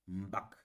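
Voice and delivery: low, subdued "Mbak". Shown as a demonstration. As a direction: drop the voice for "Mbak". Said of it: "Je fais un abaissement dans la voix".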